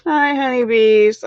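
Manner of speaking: high, slow sweet hello; sing-song greeting. Real, not acted.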